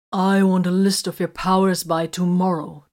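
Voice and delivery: loud firm order; demanding. A narrator's reading. Captain ordering Vox, loud firm demand. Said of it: "Titus demanded".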